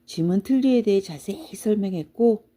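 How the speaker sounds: ordinary delivery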